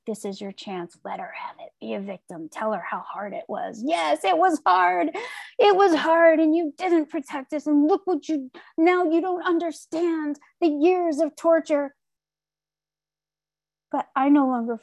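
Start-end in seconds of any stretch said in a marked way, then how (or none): 0.0-3.8 soft, half-whispered coaxing devil on the shoulder; tempting inner voice. Retold or imitated. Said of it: "the disease in me ... was like". 3.8-12.0 mock victim complaint. Imagined accusation of her mother.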